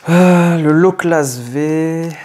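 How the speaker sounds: slow, drawn-out, thrilled reveal; excited. Real, not acted. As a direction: savour each word. Pulls a rare card.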